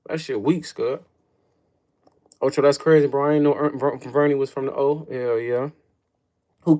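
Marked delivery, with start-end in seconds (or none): none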